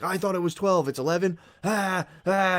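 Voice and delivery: high-pitched panicky whine ending in wordless cries; mock freak-out. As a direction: act out getting sent into a tizzy. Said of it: "would have sent me off".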